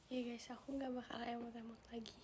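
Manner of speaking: breathy whispered promise; whispering a promise to the viewers. Real, not acted. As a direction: whisper it to the chat like a secret.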